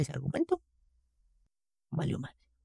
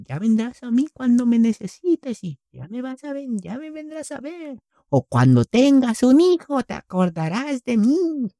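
mom voice; 8 semitones higher, slower than usual. Retold or imitated. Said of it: "Otra típica es la de".

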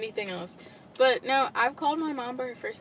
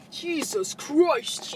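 drawn-out exasperated sigh; 4 semitones higher, much slower than usual. Pretend. Trading exclamations, slow.

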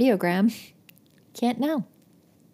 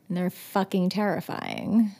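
ordinary delivery

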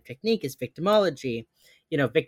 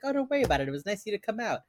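higher-pitched courteous brush-off; polite mock reassurance; 6 semitones higher, much faster than usual. Detective tells passengers driving them is no trouble, lightly acted politeness. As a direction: lift the voice, friendly and dismissive.

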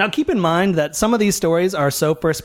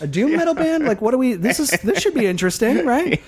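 laughing; 8 semitones higher than usual. Retold or imitated.

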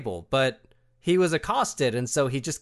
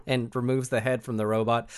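normal talking voice

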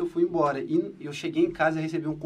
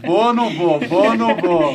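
alternando vou e não vou mais alto, encenado; indecisão caricata; 3 semitones higher, 9 dB louder, slower than usual. Retold or imitated. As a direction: hesite de brincadeira, em vai e vem. Indecisão imitada, brincando. (indecisão exagerada, mais alta e cantada)

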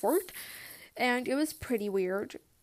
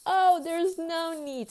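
polite refusal; 7 semitones higher, 6 dB louder, slower than usual. Retold or imitated. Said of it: "I was just like".

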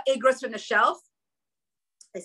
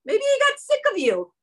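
high, louder mocking jab; taunting inner voice; 11 semitones higher, 4 dB louder than usual. A mother voices her inner doubt taunting her, high and mocking. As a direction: let the doubt sneer at her.

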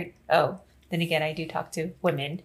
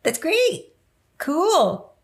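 fake enthusiasm; 8 semitones higher, 4 dB louder, much slower than usual. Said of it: "And I went".

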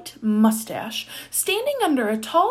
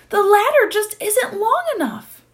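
high, raised calling voice; calling back; 12 semitones higher, 5 dB louder than usual. A narrator's reading. Gardener calling down to the Duke from ladder, calling back. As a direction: call down from up high, loud and high. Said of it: "the gardener called back".

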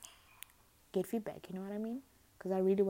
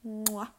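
kiss sound; 3 semitones higher, 3 dB louder than usual. Real, not acted.

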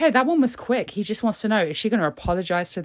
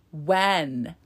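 ordinary talk, nothing marked

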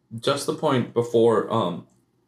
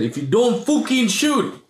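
bad Norwegian accent, high and slow; hammy Norwegian accent impression, laughing at the end; Solskjaer's Norwegian accent; 11 semitones higher, 3 dB louder, slower than usual. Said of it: "That was a terrible Norwegian Indian accent".

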